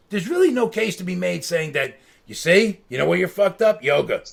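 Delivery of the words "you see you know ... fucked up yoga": loud, hammed-up blame; booming comic verdict; mock accusation. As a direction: call someone out loudly as a punchline. Acted mock accusation as punchline.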